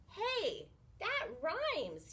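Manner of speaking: high, delighted discovery; a sudden happy idea; excited cry. Acted. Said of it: "he cried".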